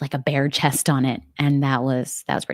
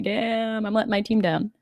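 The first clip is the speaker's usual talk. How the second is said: higher-pitched playful self-reproach; mock dismay; 7 semitones higher than usual. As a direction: lament it in mock disappointment. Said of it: "but I'm like".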